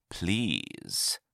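low, soft, lingering PLEASE; suggestive trader. A narrator's reading. Trader hinting to an interested buyer, soft and suggestive. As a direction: let PLEASE drop low and hang suggestively.

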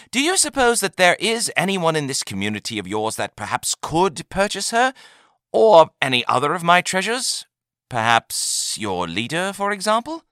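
high, probing salesman's question; wheedling trader. A narrator's reading. Trader angling to meet the buyer's leader, high and wheedling. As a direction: float the suggestion, pitched well up.

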